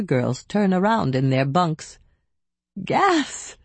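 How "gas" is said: high wheezing laugh-gasp. A narrator's reading. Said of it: "she wheezed".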